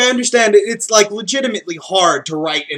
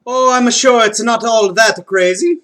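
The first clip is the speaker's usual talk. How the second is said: higher, hammed-up Mario-style Italian accent; Mario's accent, pitched up and earnest; 6 semitones higher, 4 dB louder than usual. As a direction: play it as a heartfelt Mario with his accent. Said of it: "Mario began".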